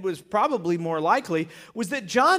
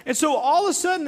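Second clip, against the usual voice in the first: ordinary talk, nothing marked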